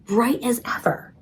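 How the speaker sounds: soft unvoiced whisper of wonder. A narrator's reading. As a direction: breathe it out in awe. Whispered awe at found ring.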